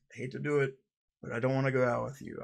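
ordinary delivery

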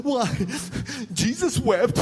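high, loud, sing-song wail with laughs; mock wailing. Counselee crying to the pastor, mock wailing. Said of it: "she's like".

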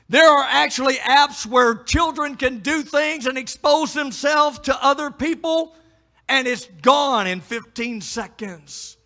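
loud, high preacher's cry; fiery preaching. Acted. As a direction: preach it hard at the men. Preacher builds warning.

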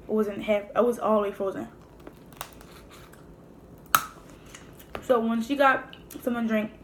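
eating crab meat between words; speaking while picking food; talking while eating. Real, not acted. Seen 1: Handles crab meat throughout, brings piece to mouth near end.

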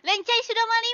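high, hammed-up catchphrase call; mock announcement. Streamer mimicking the Leng Chai clip, high mock call. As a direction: imitate a crowing entrance line, pitched high.

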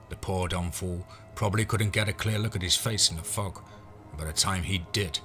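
usual speaking voice

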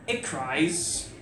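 slow, hushed spoken word delivery; poem recitation. Acted.

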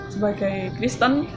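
ordinary delivery

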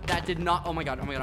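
normal talking voice

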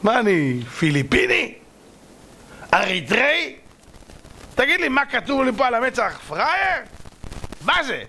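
rising, incredulous questions; acted affronted helper; indignant outburst. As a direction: protest being taken for a sucker. Helper indignant at being exploited.

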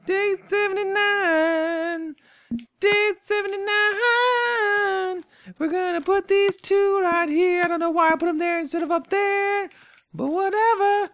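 goofy high-pitched voice, slow at first; silly high voice. Pretend. Streamer labelling signs in a goofy high voice. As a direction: squeak it out like a cartoon. Sign writing, high far voice.

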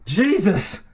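high, startled exclamation; crying out. A narrator's reading. MacLeod as his wig falls over his eyes, crying out. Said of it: "he cried".

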